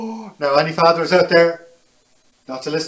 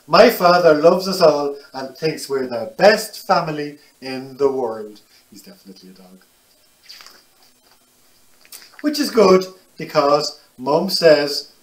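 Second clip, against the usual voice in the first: put-on lively, animated read to children; picture book reading; 4 dB louder than usual. A narrator's reading. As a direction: tell it brightly to little listeners.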